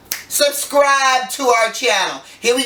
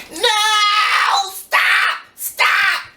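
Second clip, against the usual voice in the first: mock shout; 12 semitones higher, much slower than usual. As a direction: shriek "no, stop" at the idea. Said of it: "you're gonna say".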